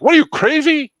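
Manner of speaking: high, loud shocked dad; dad yelling. Retold or imitated. Dad on the phone hearing about the crash, loud and shocked. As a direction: blurt it like an outraged father. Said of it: "my dad's like".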